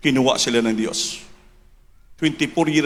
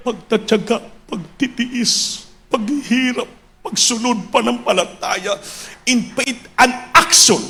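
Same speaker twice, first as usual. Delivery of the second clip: high, impassioned preacher's proclamation; full-force preaching; 10 semitones higher than usual. Real, not acted. Preacher extolling the pastors' perseverance to the church, full-force preaching. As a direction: build the list of sacrifices to a shout.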